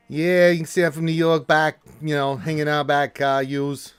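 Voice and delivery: badly faked New Yorker; bad New York accent. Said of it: "Horrible New York ac-, accent".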